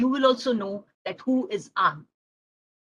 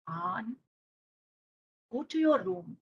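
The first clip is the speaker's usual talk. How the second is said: low, soft, restrained order; quiet, measured grandpa; calm grandpa; 3 semitones lower, 8 dB quieter than usual. Acted. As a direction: send a child away without raising the voice. Grandpa calmly sends boy off.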